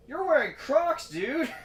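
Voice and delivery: high, loud, slow mocking squeal; teasing the neighbor about his Crocs in a high squeal. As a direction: drag it out high to tease the neighbor. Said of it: "And I was like".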